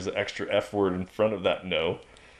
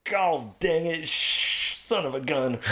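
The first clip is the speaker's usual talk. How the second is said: drawn-out, higher mock frustration; hammed-up substitute cursing; mock mild swearing; 6 semitones higher, slower than usual. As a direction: play at holding back a swear word.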